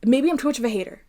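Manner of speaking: normal talking voice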